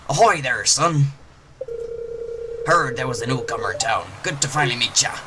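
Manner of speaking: little pirate voice. Acted. Gamer voicing the fisherman greeting the newcomer as a pirate. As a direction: growl it out like a friendly old pirate. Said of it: "Little pirate voice".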